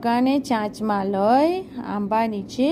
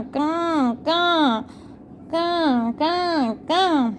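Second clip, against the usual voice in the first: imitating the crow's caw in the story; 2 semitones higher, much slower than usual. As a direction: caw like a crow for the children. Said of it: "કરવા લાગ્યો કાં".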